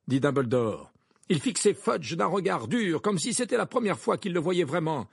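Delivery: ordinary delivery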